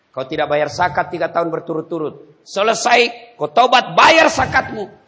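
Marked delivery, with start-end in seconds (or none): 2.4-5.1 forceful sermon; fiery preaching. Acted. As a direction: preach it loud and high.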